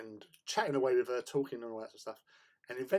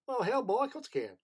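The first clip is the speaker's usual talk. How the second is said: hammed-up American, higher opening; American accent; 12 semitones higher, 4 dB louder than usual. Leader agreeing to pose as American, hammed-up American accent. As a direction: put on a big playful American accent. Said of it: "can you pretend to be American".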